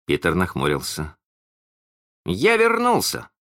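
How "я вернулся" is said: high, indignant announcement; high-pitched touch of temper; vexed. A narrator's reading. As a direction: exclaim it in a huff. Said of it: "сказал он в сердцах".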